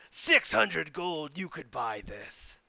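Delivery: slow sales pitch. Acted. Salesman naming the price.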